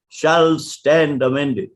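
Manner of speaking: "shall stand amended" drawn out slowly, parodying the stress; a mild parody of heavy stress on "shall stand amended"; mocking emphasis. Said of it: "poked fun at Palkhiwala's emphasis on the words".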